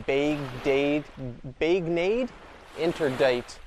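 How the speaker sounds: anglicized "Baignade Interdite" read slowly; sounding out "Baignade" as bag-nade, "Interdite" as inter-dite; reading French sign. Shown as a demonstration. Tourist reading a French sign aloud, slow anglicized. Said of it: "It says".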